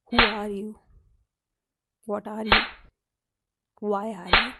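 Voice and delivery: loud, pointed questions; dramatic questioning. Acted. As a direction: demand it like a crisis.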